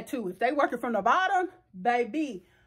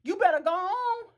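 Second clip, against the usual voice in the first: sassy warning; 8 semitones higher than usual. Retold or imitated. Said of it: "You gotta be like".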